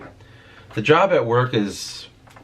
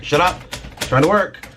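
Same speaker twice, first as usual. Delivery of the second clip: higher-pitched, mock-irritated retort; mildly exasperated comeback, pitched up; 7 semitones higher than usual. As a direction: act out telling off a nagging voice. Acts snapping back at inner voice.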